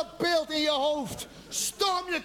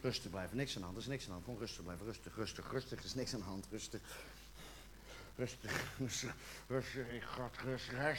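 very quiet, low monotone self-soothing; hushed repeated reassurance, barely holding it in; calming himself; 13 semitones lower, 15 dB quieter than usual. Acted. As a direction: mutter it to yourself to keep control.

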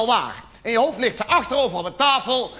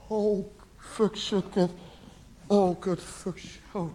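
soft, halting curses; subdued dismay, broken by long groans; stunned regret; 9 dB quieter, much slower than usual. Acted. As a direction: let quiet swearwords fall out one by one. Acted regret after she leaves.

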